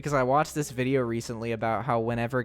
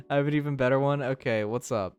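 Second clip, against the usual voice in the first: usual speaking voice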